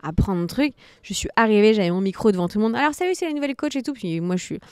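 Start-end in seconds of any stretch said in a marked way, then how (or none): 2.8-3.9 high, quick coach greeting; feigned easy confidence. Retold or imitated. New coach greeting her class on the mic, pretending to be at ease. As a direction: rattle off a higher-pitched hello to the class. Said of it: "je devais faire genre".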